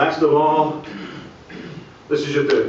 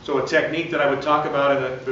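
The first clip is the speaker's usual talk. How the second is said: ordinary delivery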